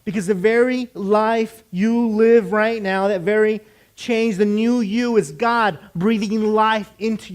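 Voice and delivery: loud, slow, emphatic sermon delivery; full-force preaching. Real, not acted. Preacher telling listeners they are free, full-force proclamation. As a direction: drive each phrase home loudly, drawing out words.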